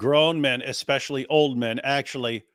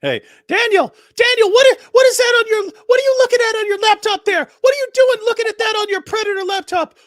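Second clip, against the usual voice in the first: high-pitched, loud busybody calling out; mock scolding shout; 10 dB louder than usual. Someone catches Daniel looking at his laptop, high mock shout. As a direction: shriek accusing questions in a put-on high voice.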